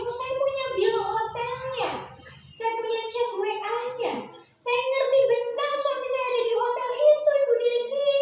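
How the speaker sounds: high imitating voice of a wife with proof; insistent wife. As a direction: protest like a hurt wife with proof. Wife insisting she has proof.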